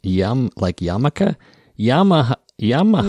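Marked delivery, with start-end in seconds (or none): 1.8-3.1 higher, punchy "YAH-muh-hah" for Yamaha; imitating pronunciation guide. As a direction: parrot the guide's open "ah" in Yamaha. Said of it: "this is a pronunciation guide".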